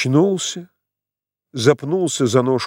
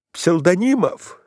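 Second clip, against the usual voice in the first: calling out; 5 semitones higher than usual. A narrator's reading. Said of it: "кричал Иван Ильич".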